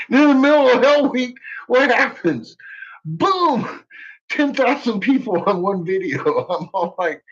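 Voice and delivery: ordinary talk, nothing marked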